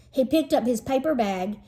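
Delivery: ordinary delivery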